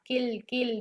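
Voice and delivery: किल, किल repeated clearly with no noon; stressed syllable drill. Shown as a demonstration. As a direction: isolate किल, crisp ल, no nasal. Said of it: "नून की आवाज़ नहीं आनी चाहिए".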